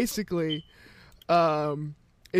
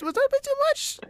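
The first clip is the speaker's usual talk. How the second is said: very high, mock-worried question; sheepish checking. Checking whether the joke went too far, sheepish. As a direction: squeak it up high, half-joking worry.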